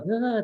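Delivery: a short high mock groan of frustration; frustrated groan. Mom imitating a parent's frustrated groan when emotions rise. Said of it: "you get kind of like".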